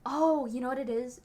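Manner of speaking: ordinary talk, nothing marked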